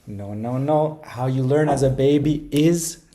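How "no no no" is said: usual speaking voice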